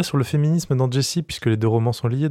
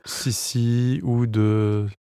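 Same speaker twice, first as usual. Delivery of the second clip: very slow, drawn out; over enunciating; much slower than usual. Acted. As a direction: stretch every word out. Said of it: "je vais parler très lentement".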